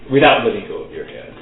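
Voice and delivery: usual speaking voice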